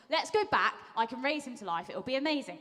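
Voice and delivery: rally reluctant friends with upbeat energy; eager rallying. Acted. Jesus urging the disciples back to Judea, eager rallying.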